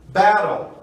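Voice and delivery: "battle" with the T as a quick tapped R, "bat-tle" becoming "ba-rl"; flapped T. Shown as a demonstration. Teacher demonstrating to Brazilian learners: "battle" with a tapped T. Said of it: "O T passa a ter som de R".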